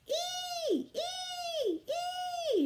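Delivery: very high piercing squeals; toddler screech. A narrator's reading. Tootsie joining the chaos, high screeching. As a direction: screech like a little kid. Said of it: "she screeched as loud as she could".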